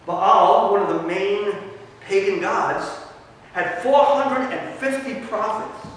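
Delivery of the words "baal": ordinary delivery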